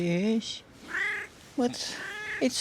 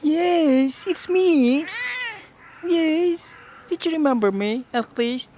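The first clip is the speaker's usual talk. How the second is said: higher and louder, delighted to be recognized; excited reply to pet; 5 semitones higher, 4 dB louder, slower than usual. Real, not acted. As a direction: answer the cat with glee. Cat recognizes her, she answers.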